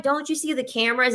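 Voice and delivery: high, quick mock-alarmed question; mock warning. Acts out warning client.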